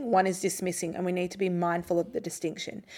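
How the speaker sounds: usual speaking voice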